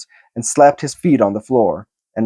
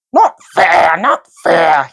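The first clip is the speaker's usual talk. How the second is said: high, hissing protest; hissed; 6 semitones higher, 4 dB louder, slower than usual. A narrator's reading. Gollum hissing at Bilbo, high and upset. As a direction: spit out the complaint like a creature. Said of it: "he hissed".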